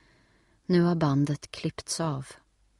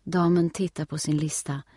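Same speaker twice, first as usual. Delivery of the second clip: ordinary delivery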